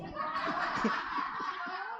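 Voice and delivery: soft unvoiced laugh; breathy laugh. Real, not acted. Pastor laughing to the congregation, soft and airy. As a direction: let out a quiet airy laugh.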